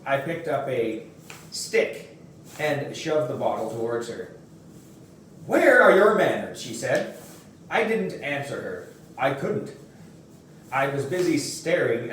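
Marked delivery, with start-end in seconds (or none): 5.5-6.6 higher and louder, a mother's rebuke; sharp scolding. Acted. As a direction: snap it at the rude kid. Mother scolds boy over bottle.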